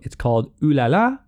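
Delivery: usual speaking voice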